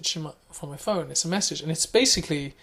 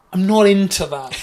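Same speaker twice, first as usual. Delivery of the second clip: dismissive mock; 4 semitones higher, 9 dB louder than usual. Mocking a non-music person.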